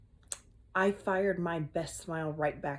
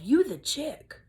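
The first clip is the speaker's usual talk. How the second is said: put-on cocky kid remark; 7 semitones higher, 7 dB louder, slower than usual. Kid whispers to narrator.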